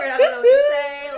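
high, loud laugh-crying through words. Real, not acted. Recalling an awkward moment with her partner, laughing loud and high.

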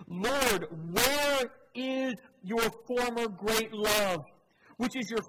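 desperate lament called out to the Lord; anguished outcry. Acted. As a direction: let the verse break open in a slow cry. Crying out the psalm.

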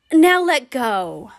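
ordinary delivery

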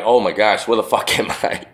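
whispery, lightly voiced groggy kid with a laugh; dazed waking kid. Imitating a kid waking up from the pass out game, whispery and dazed. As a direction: breathe it out like someone just coming to.

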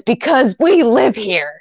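mock flustered. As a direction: imitate a flustered man groping for a reason. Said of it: "gets all flustered".

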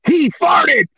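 put-on high, loud child's outburst; kid blurting out. Little kid calling out the mascot at an autograph, shrill glee. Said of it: "they just burst out".

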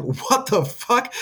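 high, slow drawn-out disbelief; shocked cinema reaction. Retold or imitated. Viewer stunned by the plot twist in the theater, slow disbelief. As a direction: stretch it out in shock. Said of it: "I was like".

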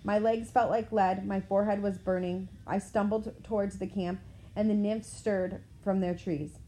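plain reading voice

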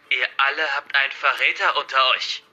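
villain's menacing announcement. Acted. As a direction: lay down a forceful threat to hostages. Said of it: "Er klang jetzt noch bedrohlicher als vorher".

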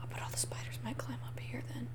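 hushed whisper. Real, not acted. Spooky joke about spiders.

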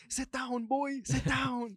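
high, loud bossy order; commanding tough guy. Retold or imitated. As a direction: order him to sit down. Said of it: "Be like".

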